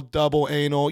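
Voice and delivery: usual speaking voice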